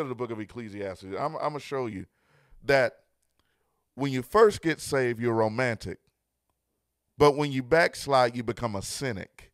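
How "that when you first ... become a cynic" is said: loud, rising preacher's cadence; full-force preaching. Acted. Pastor driving home love is blind to his viewers, loud preaching. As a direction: preach it with building fire.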